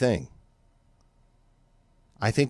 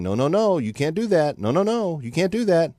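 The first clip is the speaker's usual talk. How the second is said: higher-pitched, singsong telling-off; lightly acted chiding, pitched up; mock scolding; 6 semitones higher than usual. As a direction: play a nation chiding like a parent. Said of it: "the U S is saying".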